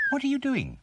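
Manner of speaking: very high chirpy bird voice; chirping bird. A narrator's reading. Birdie flies down to Bump, chirping. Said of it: "chirped Birdie".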